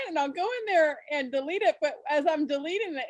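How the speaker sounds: ordinary delivery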